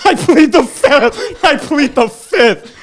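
laughing high and slow. Acted. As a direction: giggle through it, drawn out. Hammed-up repetition, laughing.

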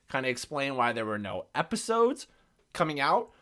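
usual speaking voice

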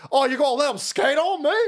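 high-pitched retold yell at the cop; woman yelling at the cop, retold; imitating her yelling. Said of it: "she starts yelling at the cop".

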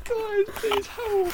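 put-on very high, drawn-out kid's plea; high slow pleading child. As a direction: whimper for help, slowly. Acted beaten child begging.

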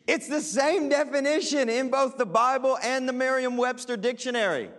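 normal talking voice

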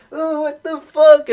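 imitating a grossed-out crowd, high and loud; mock shock. Crowd splashed by a friend's vomit, shocked.

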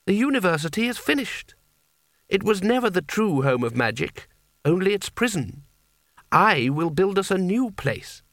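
high, measured pronouncement; commanding and declamatory; imperious boy wizard. A narrator's reading. Voices Coin pronouncing judgement.

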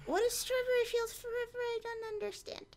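high, quiet little voice; feigned confusion. Pretend. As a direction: play clueless, small and high-pitched. Feigning ignorance of the song.

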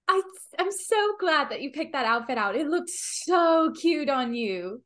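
put-on delighted girly compliment in character; gushing compliment. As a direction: gush warmly, stretching the "so". Player gushes in character at Willow.

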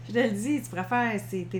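usual speaking voice

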